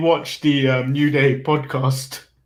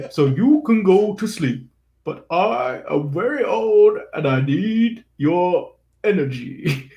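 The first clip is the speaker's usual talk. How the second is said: slow, drawn-out story voice; Big E; 3 semitones higher, slower than usual. Retold or imitated. Wrestler telling a baby to go to sleep, slow storyteller. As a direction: stretch the words like a mock bedtime tale. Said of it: "decides to go in a big story voice".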